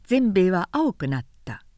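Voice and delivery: ordinary delivery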